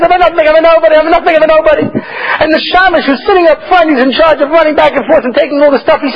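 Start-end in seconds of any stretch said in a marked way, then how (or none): 0.0-1.9 lightly voiced, high humble cry; the Rav's plea. The Rav joining the chazan, pleading high. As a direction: echo the cantor's plea as the rabbi. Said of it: "He says".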